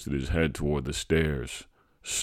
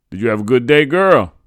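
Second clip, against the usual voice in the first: loud, raised-pitch father's question; father's greeting; 9 semitones higher, 13 dB louder than usual. A narrator's reading. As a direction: call out a hearty question on coming home. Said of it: "my father asked".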